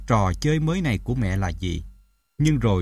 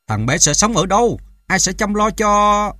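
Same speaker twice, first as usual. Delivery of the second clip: raised, loud, pleading questions; distressed questioning; 7 semitones higher, 6 dB louder than usual. A narrator's reading. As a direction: plead with her, drawing out the end. Distraught father pleads for child. (chất vấn khẩn khoản)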